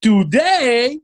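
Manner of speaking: high, slow, dramatic vlogger intro; Nas impression. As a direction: announce it like a hyped explainer video. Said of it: "שנאס תמיד אומר כזה".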